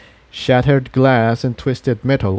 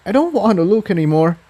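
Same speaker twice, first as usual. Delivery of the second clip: upset Tiptoe; 8 semitones higher, faster than usual. A narrator's reading. Tiptoe upset, fast, higher.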